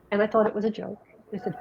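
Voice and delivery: normal talking voice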